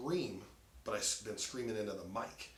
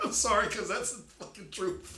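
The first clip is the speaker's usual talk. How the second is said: blurted through a big laugh, louder and higher; 12 semitones higher, 10 dB louder than usual. Real, not acted. Friend agreeing that recordings hurt, laughing loudly. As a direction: crack up while agreeing.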